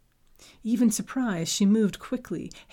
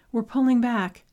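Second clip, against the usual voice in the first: calling out; 5 semitones higher, 8 dB louder than usual. Acted. Calling out in storm.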